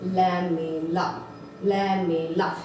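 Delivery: लैमेला said slowly, low and drawn out syllable by syllable; spelling out the term. Shown as a demonstration. Teacher to class, sounding out लैमेला slowly. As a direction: sound out लैमेला carefully for students. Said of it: "नाम क्या बन गया इसका".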